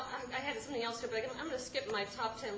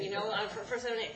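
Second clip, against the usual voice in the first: ordinary talk, nothing marked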